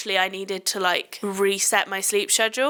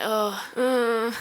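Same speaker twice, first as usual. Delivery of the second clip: mock bored sigh, drawn out; a touch of weary boredom; bored groan. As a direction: act out being fed up mid-shift. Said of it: "I get a bit bored".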